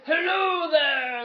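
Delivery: drawn-out, booming high greeting; Wizard of Oz god. Retold or imitated. Egyptian god greets the dreamer in the room, booming theatrically. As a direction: call it out like a showy, all-powerful wizard. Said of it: "kind of like the Wizard of Oz".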